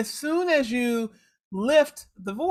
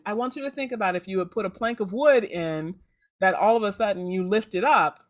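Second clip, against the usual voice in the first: ordinary talk, nothing marked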